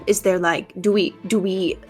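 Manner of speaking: ordinary delivery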